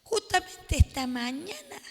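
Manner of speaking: ordinary talk, nothing marked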